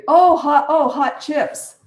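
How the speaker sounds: high, loud "oh" of sudden understanding; a slow, drawn-out "oh, hot chips"; dawning realization, slowed; mock realization. Cashier realizing the word.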